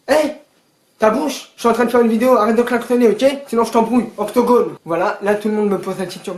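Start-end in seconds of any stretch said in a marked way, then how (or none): 0.1-4.8 louder angry call at a honking car; angry yell. Real, not acted. Vlogger yells at a driver honking during filming, angry. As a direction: tell the driver off, loudly.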